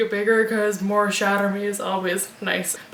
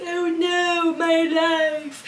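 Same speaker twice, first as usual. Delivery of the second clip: higher, mock-despairing wail; whiny damsel; 5 semitones higher, much slower than usual. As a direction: ham up the helpless old Juliet. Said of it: "old Juliet who's like".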